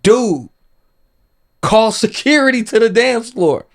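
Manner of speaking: high, loud call for help; urgent shout. Retold or imitated. Panicked call for security in fight.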